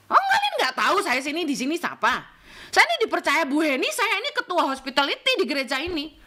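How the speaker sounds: mock pompous boasting, high and forceful; self-important. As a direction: pull rank loudly on everyone. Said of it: "Jangan mentang-mentang gitu loh".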